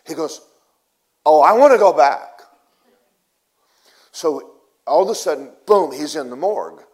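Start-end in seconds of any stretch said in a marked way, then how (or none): 1.2-2.4 mock desperate cry, high and very loud; loud plea. Dead man answering Jesus's offer to go back, crying out. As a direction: wail it out eagerly.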